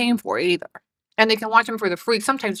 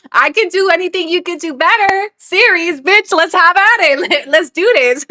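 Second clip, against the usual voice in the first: loud, high-pitched challenge, breaking into a laugh; fired-up challenge; 7 semitones higher, 4 dB louder than usual. Real, not acted. Streamer challenging her rival to a contest, fired-up and loud. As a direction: throw down the dare with rising gusto.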